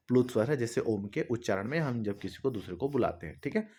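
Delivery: ordinary talk, nothing marked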